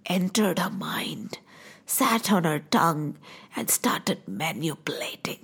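slow, low, hushed narration; ominous storytelling. A narrator's reading. Storyteller describing magic worked on Manthara's mind, ominously.

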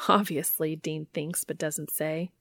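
ordinary delivery, nothing marked

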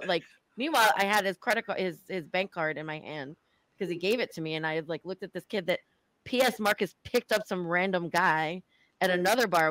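normal talking voice

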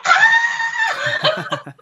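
very high squeal breaking into laughter; high imitation scream, not full volume; sister's excited scream. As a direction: mimic a starstruck sister's shriek. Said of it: "my sister was like".

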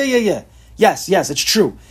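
ordinary talk

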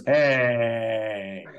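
drawn-out mock objection; diner cook objecting. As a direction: stretch it out like an offended counterman. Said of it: "And he goes".

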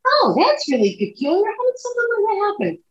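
high, airy mock-puzzled voice; mock puzzled girls. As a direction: play it up as baffled but dismissive. Girls dismissing odd event.

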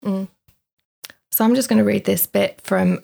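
ordinary talk

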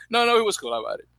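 usual speaking voice

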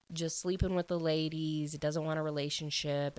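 ordinary talk, nothing marked

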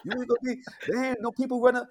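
mock scolding father voice. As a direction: play the angry pops shouting at mom. Pops scolding mom in story.

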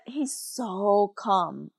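softly and slowly, easing the words out; slow, soft calm. Retold or imitated. Telling the host how calm dad stayed, slow and soft. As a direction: let the word calm sound calm itself. Describing dad's calm in the boat.

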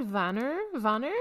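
"Vanir" as VAH-ner, rising at the end; demonstrated pronunciation. Shown as a demonstration. Co-host showing the host how they said it, "Vanir" as VAH-ner. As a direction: stress the first syllable of "Vanir", ending like "ner". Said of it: "I said Vanir".

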